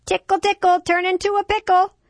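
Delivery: put-on very high, loud child's chant; yelling little boy. A narrator's reading. Five-year-old yelling as he chases the dog, gleeful chant. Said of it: "trying to catch the dog and yelling".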